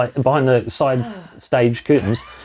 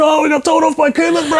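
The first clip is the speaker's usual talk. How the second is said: teen mocking; 13 semitones higher than usual. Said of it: "cracks up and went".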